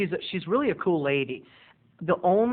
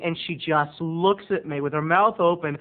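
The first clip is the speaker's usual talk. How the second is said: ordinary delivery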